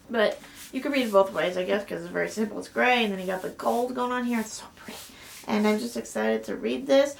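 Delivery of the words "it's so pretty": soft awed whisper. Real, not acted. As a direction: murmur it to the book in delight. Whispering admiration of cover.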